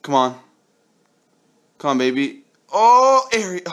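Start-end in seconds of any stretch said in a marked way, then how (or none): 2.7-3.6 sudden high, loud "oh" of hope; a yelp as the pull almost lands; surprised gasp. Real, not acted. Gacha pull, almost a legend.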